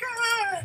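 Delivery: mock cry. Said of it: "you're like".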